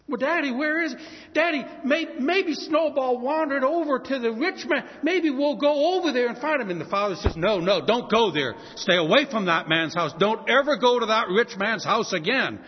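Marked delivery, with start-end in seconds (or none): 0.1-6.7 put-on high, loud eager kid voices; pleading children. Children begging father to search the rich man's house, high and eager. 7.4-12.6 loud, firm forbidding; stern warning. Acted. Father forbidding the children to go near the rich man's house, loud and firm.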